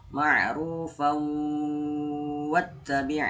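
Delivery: slow recitation, long held "fau" in "Ma'rufau". Shown as a demonstration. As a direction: linger on the end of "Ma'rufau", then into "wattabi'". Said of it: "Nah, ditahan ya".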